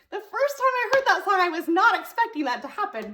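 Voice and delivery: ordinary talk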